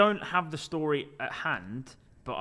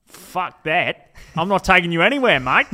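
louder hammed-up Australian accent, fast on the refusal; mock Australian accent; 3 semitones higher, 11 dB louder than usual. Dad refusing the stranger a lift, loud mock Australian accent. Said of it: "continue with the accent".

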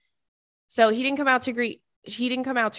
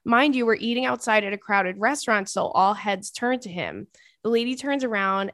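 ordinary delivery

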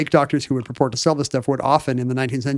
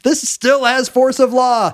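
high, slow solemn declaration; pompous pronouncement; 8 semitones higher, 3 dB louder, slower than usual. Pretend. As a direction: pronounce it like law. Grand proclamation.

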